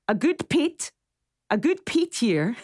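put-on high, halting try at a Shetland accent; radio host struggling to copy a listener's Shetland accent; attempted Shetland accent. A first go at a Shetland accent, pitched high. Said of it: "I'm trying to do the accent".

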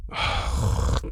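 long breathy sigh; soft, weary exhale of impatience; exasperated sigh. Real, not acted. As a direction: sigh heavily, fed up. Host fed up with the talk.

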